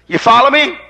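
ordinary delivery

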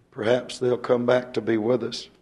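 ordinary talk, nothing marked

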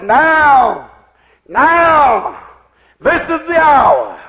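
fiery revival preacher crying out the voice's message; full-force preaching. Acted. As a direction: build the proclamation up, hammering every Now.

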